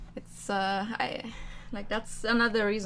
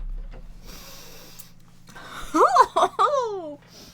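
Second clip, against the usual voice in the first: loud high-pitched laugh; 10 semitones higher, 4 dB louder than usual. Real, not acted. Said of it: "I didn't think they would be this sour".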